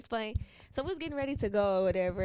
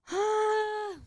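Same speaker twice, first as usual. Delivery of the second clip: exasperated cry; 12 semitones higher, 8 dB louder than usual. Retold or imitated. Reacting to girl hitting baby.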